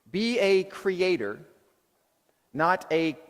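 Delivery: slow, deliberate call; preacher's slow motto; slow emphatic. Shown as a demonstration. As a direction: spell it out slowly to the room. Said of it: "Let's repeat this one".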